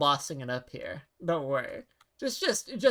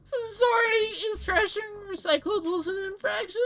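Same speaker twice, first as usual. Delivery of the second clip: very high, squeaky apologetic voice; high-pitched, anxious newbie stammering sorry; flustered clumsy worker; 3 dB louder than usual. Acted. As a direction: blurt panicky apologies in a tiny high voice.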